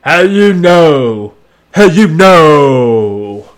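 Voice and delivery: loud, slow, drawn-out mock demand; Dick Grayson. Detective challenging the girl from the dream, mock dramatic. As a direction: stretch each word in a put-on voice. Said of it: "And Dick Grayson's like".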